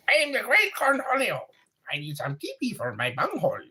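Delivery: high, fast frantic cartoon shriek; Cornholio impression. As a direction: rant like a manic cartoon teen. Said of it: "Beavis and Butthead".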